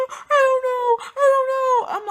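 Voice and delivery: high, loud, tearful wail; crying. Retold or imitated. Cousin crying to security at the hotel, sobbing. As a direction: mimic the cousin sobbing helplessly.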